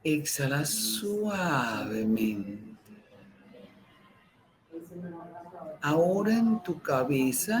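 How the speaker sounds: slow, soft meditation voice with long pauses; guided meditation. Acted. Teacher guiding listeners through breathing, calm meditation voice. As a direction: breathe the words out, calm and unhurried.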